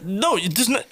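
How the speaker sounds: ordinary talk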